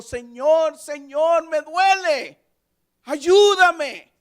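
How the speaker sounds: high, loud, anguished cry for help; pained plea. Retold or imitated. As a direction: cry out to the Lord like a hurting child. Believer crying to God in pain.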